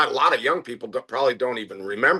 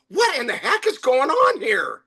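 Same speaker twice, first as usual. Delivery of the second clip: high, alarmed outburst; panicked; 12 semitones higher than usual. Retold or imitated. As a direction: burst in asking in a panic. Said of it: "he come running down in a panic".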